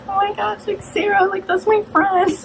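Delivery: high-pitched fangirl gushing; retold excitement. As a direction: act out thrilled pride in a friend. Retold thrill at friend on TV.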